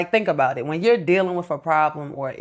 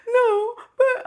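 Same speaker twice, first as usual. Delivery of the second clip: put-on much higher, crying child's voice; imitating crying son. Mother mimics her crying son protesting, in a high, tearful voice. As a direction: whimper it like a kid in tears. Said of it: "He was like".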